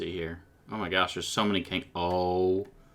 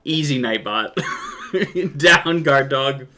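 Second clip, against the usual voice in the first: laughing dog command; 5 semitones higher, 6 dB louder, slower than usual. Pretend. Scolding bot like a dog.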